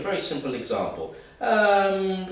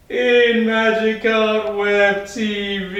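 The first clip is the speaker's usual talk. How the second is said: loud, high desperate wail; full-volume drawn-out plea; desperation; 12 semitones higher, 15 dB louder, slower than usual. Shown as a demonstration. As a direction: cry it out desperately, long and drawn. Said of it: "what about desperation?".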